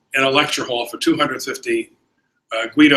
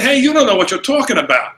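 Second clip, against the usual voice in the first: higher, heated retort; indignant rebuke; 8 semitones higher, faster than usual. Retold or imitated. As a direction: push back hard at the driver. Student rebukes driver.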